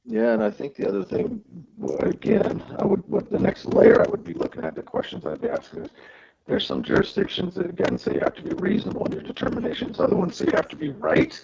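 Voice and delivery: crackly, broken-up headset audio; distorted mic cutting in and out; audio distorting. Real, not acted. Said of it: "your audio is distorting".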